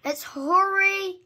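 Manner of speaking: usual speaking voice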